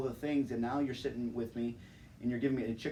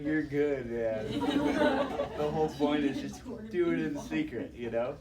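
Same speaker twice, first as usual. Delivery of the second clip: God's reply; 4 semitones higher, 5 dB louder than usual. Retold or imitated. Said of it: "And he's like".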